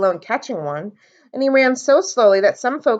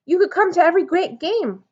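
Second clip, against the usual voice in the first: eager; 7 semitones higher than usual. A narrator's reading. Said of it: "said Sam".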